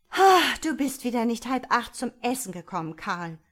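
exasperated mother telling off her son; scheltend. A narrator's reading. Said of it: "schalt sie". (laut und erhoben scheltende Mutter)

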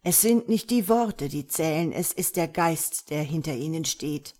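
ordinary delivery

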